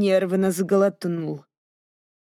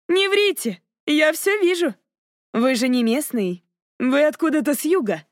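put-on teasing young lady; 6 semitones higher than usual. A narrator's reading. Narrator voices Cassandra.

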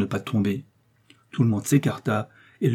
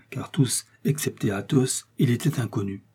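ordinary delivery

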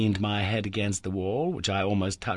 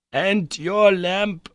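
calling out; 11 semitones higher, 6 dB louder, much slower than usual. Acted. Uncle calls through rock.

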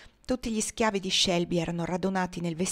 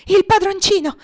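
joyful shout, much higher and louder; excited cry; 12 semitones higher, 9 dB louder than usual. A narrator's reading. Chloe rushing to the window, calling out in joy. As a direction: call out the young master's arrival with joy. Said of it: "esclamò Chloe correndo alla finestra".

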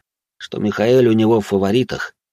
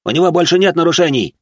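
high, loud, fast protest; rushed, raised-voice objection; assistant yelling back; 9 semitones higher, 5 dB louder, faster than usual. Acted. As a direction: shout back in exasperation. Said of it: "заорал и помощник".

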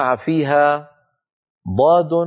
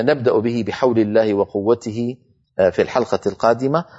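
ordinary delivery

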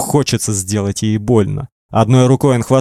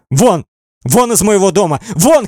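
high, mounting cries of dismissal; shouting; 9 semitones higher than usual. A narrator's reading. Girl throws the man out of her house, shouting high. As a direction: climb higher with each order to leave. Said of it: "кричит она".

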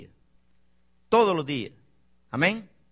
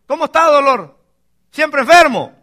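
higher, louder call, lightly acted townsfolk; mock taunting greeting; 8 semitones higher, 9 dB louder than usual. Townsfolk greeting Jabes by his painful name, mock taunting. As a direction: call it out as a hurtful nickname.

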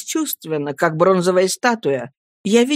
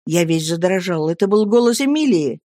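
plain reading voice

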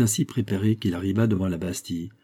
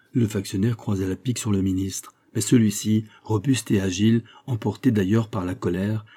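usual speaking voice

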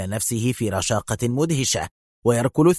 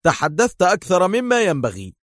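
higher, scolding jab; taunting; 7 semitones higher than usual. A narrator's reading. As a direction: throw it like a punch. Hero taunts while punching.

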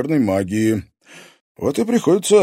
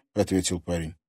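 ordinary delivery, nothing marked

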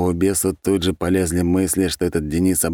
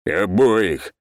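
angry bark; 3 semitones higher than usual. Acted. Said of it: "в сердцах гаркнул Броня".

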